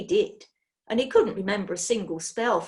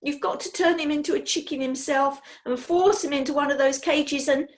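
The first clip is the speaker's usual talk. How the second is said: higher, loud eager outburst; upset boy; 6 semitones higher, 5 dB louder than usual. Acted. Boy begging the witch for revenge, higher and loud. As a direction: tumble it out in a rush of outrage. Daniel's furious plea.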